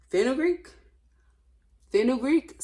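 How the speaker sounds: high, loud questioning rise; incredulous. Real, not acted. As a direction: repeat it in disbelief. Incredulous repetition of ingredient.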